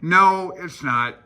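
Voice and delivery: ordinary talk, nothing marked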